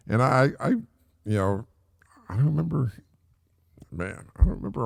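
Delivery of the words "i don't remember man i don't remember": a quick whispered aside to himself. Real, not acted. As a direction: drop to a whisper, half to himself.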